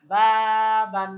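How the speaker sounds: teacher projecting বাবান for learners; slow loud model. Shown as a demonstration. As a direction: call বাবান out slowly to the class. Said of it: "কি উচ্চারণ?".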